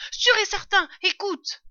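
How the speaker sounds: high, loud, urgent reply; alarmed insistence. A narrator's reading. Father fox answers doubting wife, loud and alarmed. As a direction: insist at near-shouting pitch.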